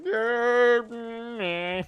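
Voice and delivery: dismissive meh. Retold or imitated. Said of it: "Rannoch simply laughs ... like".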